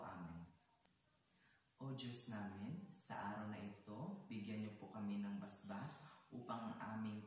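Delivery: low, soft, measured praying; hushed, reverent classroom prayer. Acted. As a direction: lead the class quietly in prayer. Leads the opening class prayer.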